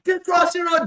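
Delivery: loud, high taunting call from a car; a driver hollering out the window; mock yelling driver. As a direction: yell it like a driver mocking him across the road. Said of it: "and a man just said".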